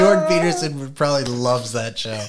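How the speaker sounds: loud, laughing words. Real, not acted. Cracking up picturing Jordan Peterson loving the show, laughing. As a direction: crack up while you say it.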